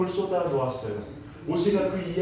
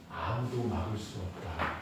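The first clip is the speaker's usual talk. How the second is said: low, soft solemn pronouncement; hushed declaration; 8 semitones lower, 10 dB quieter than usual. Retold or imitated. God promising his people the way, hushed declaration. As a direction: drop low and quiet with certainty.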